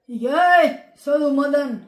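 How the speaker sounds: put-on loud village-boy shout at the teacher; rustic yell. Country boy answers the teacher in Hindi class, loud yell. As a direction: bellow it out like a bumpkin.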